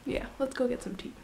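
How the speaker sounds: ordinary talk, nothing marked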